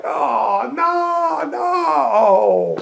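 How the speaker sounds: high, hammed-up cry of disappointment; comic despairing no no; mock dismayed wail. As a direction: wail like the moment was snatched away. Acting out ruined orgasm.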